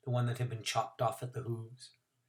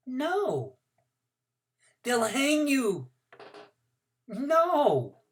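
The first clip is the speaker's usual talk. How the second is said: put-on stubborn little girl; 11 semitones higher, 4 dB louder, much slower than usual. A narrator's reading.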